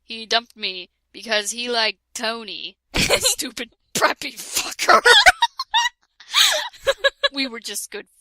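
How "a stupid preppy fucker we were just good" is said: normal talking voice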